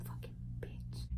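unvoiced, under-the-breath insult; whispered curse. Real, not acted. Muttering at mom in aside, whispered. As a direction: hiss it off to the side.

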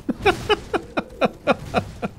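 squeaky shocked giggle; high incredulous laugh. Real, not acted. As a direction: laugh in disbelief. After being headbutted.

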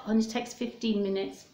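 ordinary delivery, nothing marked

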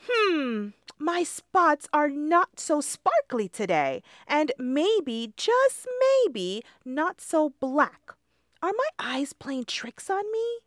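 high, puzzled little voice; high voice, growing unsure; worried ladybug. Acted. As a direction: squint at the reflection, doubting. Lindy worries about her spots.

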